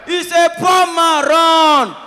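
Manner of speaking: put-on high, loud warning shout to the girls. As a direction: yell it out as a warning.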